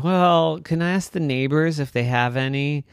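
lightly voiced kid dinosaur. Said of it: "And Rascal said".

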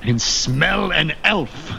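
menacing sniffing captain. Acted.

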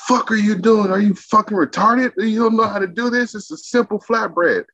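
imitating an angry chef, pitched very high; high-pitched mocking rant of a chef berating a cook; furious chef. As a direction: rip into him like a boss losing it over a flatbread. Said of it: "he's like".

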